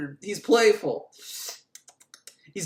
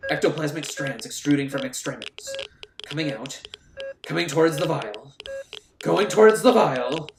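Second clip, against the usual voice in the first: eerie mock narration; 3 semitones lower than usual.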